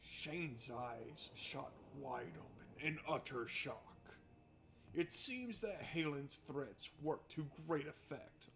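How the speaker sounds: quiet, measured storytelling voice; calm narration of a prison scene; story narration. A narrator's reading. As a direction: read the scene like a novel's narrator.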